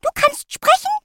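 very high, tiny squeak; squeaky guinea pig voice. A narrator's reading. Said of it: "piepte es".

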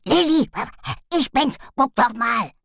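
loud, overjoyed little dog; bouncing with joy, loud; excited dog. A narrator's reading. Dog yapping.

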